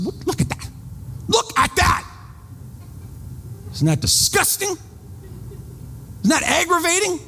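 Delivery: mock outrage, hissed then shouted high; hammed-up fury at a coffee-shop sign; retold rage. Pointing at the sign for his friend, mock-enraged and shouting. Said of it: "I was enraged".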